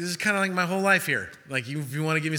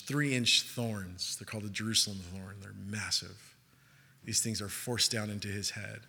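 solemn preaching; 8 semitones lower, 6 dB quieter than usual. Acted. Pastor describes the crucifixion to the congregation, hushed and grave. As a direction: lower the voice and let each horror land.

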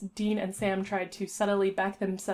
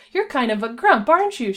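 high, loud, bouncy teasing; bubbly cartoon curiosity; perky pony; 4 semitones higher, 7 dB louder than usual. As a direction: chirpily size up a grouch. Hyper pony teases grumpy stranger.

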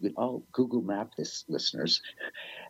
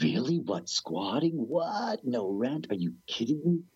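acted disbelief, pitch leaping up on the questions; incredulous; 4 semitones higher than usual. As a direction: replay being stunned by the news. Stunned at living rent-free.

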